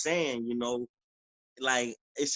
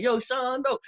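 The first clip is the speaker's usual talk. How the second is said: high, loud street greeting; raised father's call; 10 semitones higher, 3 dB louder than usual. Retold or imitated. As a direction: hail him from the driveway. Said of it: "He'd be like".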